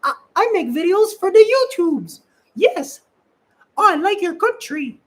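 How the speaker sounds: very high, loud, chirpy self-parody; bubbly Mickey Mouse voice. Retold or imitated. Bubbly traveler charming people while filming, squeaky cartoon voice. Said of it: "I'm so bubbly and I'm like".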